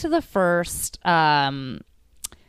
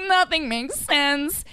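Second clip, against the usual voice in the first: whiny despair; 8 semitones higher, slower than usual. Retold or imitated. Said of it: "I'm like".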